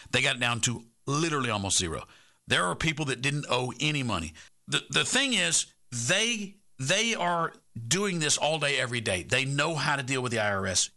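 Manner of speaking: sponsor ad read. Acted. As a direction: sell it to listeners with pushy energy.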